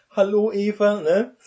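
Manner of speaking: Adam's bright, loud, fast pickup greeting; mock Adam greeting. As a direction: ham up a cheerful first meeting. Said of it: "nur weil Adam gesagt hat".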